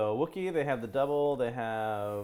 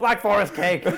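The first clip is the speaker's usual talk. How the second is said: shouted out; 9 semitones higher, 8 dB louder than usual. Pretend. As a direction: blurt it out loud, laughing. Shouting out German regions.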